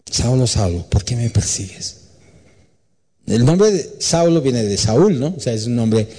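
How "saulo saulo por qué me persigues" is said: hushed voice of God. Acted. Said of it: "y le dice la voz".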